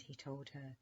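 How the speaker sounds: ordinary delivery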